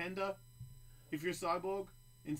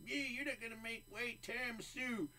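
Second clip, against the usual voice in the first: higher, lightly mocking taunt; mocking fighter voice; 9 semitones higher, 4 dB quieter than usual. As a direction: taunt him in a thin voice. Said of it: "Tony Ferguson".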